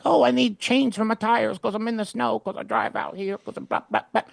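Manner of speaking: high-pitched mock caller voice; hammed-up high voice, running on into blah-blah; put-on trivial caller. Routine caller pestering 911 operator, mocking high voice. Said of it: "It's like someone going like".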